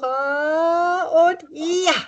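high, drawn-out shout of yes; strong Ja cheer; powerful yes. Shown as a demonstration. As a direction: throw the arms down on Ja. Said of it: "lade ich euch dazu ein".

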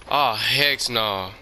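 ordinary talk

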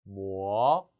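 뭐 starting low and climbing; rising tone. Shown as a demonstration. Teacher telling learners to raise it, rising 뭐. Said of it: "올리세요".

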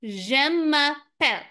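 je m'appelle said slowly with French zh; French model. Shown as a demonstration. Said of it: "you say".

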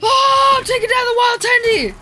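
high, loud victory yell; excited shout. Real, not acted. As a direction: yell it out in triumph. Excited yell during fight.

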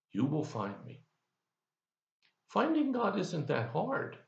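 normal talking voice

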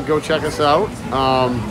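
ordinary talk, nothing marked

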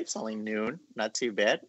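normal talking voice

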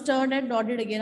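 usual speaking voice

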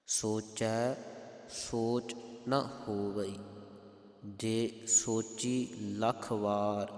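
slow verse with spaced words; measured recitation. Shown as a demonstration. Teacher models the line for congregation, slow measured recitation. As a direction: recite deliberately, pausing after ਸੋਚੈ.

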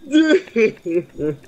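ordinary talk

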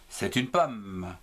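"une pomme" said slowly, each word distinct; name it slowly for the children; slow clear naming. Shown as a demonstration. Teacher to pupils, answering slowly and clearly. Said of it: "Comment s'appelle-t-il?".

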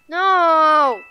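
loud anguished no; shout. Real, not acted. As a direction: cry out no in protest. Pleading over losing a pet.

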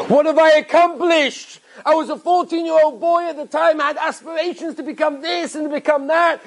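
high, impassioned cry of regret; acted soul-searching at full pitch; anguished outcry. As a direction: cry it out in self-reproach. Acted rabbi cries out in regret.

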